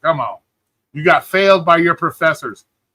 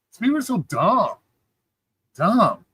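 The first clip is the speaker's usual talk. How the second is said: ordinary talk, nothing marked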